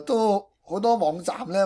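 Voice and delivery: ordinary talk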